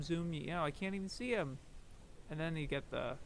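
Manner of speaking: normal talking voice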